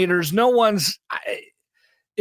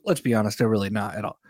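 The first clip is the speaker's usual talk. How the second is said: dry sarcastic murmur; deadpan aside; 9 semitones lower, 5 dB quieter, faster than usual. Real, not acted. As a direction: drop the voice for a dry joke. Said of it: "I'm being facetious".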